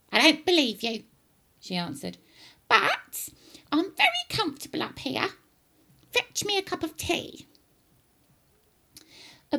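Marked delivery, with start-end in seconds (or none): 0.1-1.0 loud, high granny retort; raised-pitch grandma dismissal; disbelieving grandma. Acted. Acting Grandma. 2.7-7.5 high-pitched, demanding granny; very high old-woman voice; bossy grandma. Acted. Acting Grandma.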